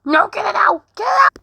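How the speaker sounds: high, loud frightened yelling; crying out in rising panic; panicked shout. Pretend. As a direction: scream for someone to take it away. Panicked at the body.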